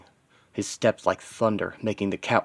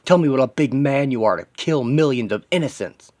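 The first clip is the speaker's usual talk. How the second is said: loud, goading challenge; angry taunt; 4 semitones higher, 5 dB louder than usual. Acted. Hero taunting the villain buried in wreckage, angry. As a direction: hurl the taunt with raised pitch and volume.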